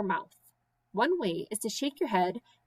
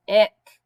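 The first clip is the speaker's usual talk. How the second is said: retching ick; 11 dB louder than usual. Acted. Child feeling sick.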